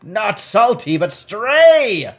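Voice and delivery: loud, high sailor-woman bray. Acted. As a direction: bray it out, stretching the last word. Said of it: "she brays".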